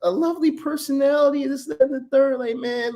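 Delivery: high sweet mocking voice; simp voice. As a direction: plead in a high gentle simp voice. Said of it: "Like, why, like".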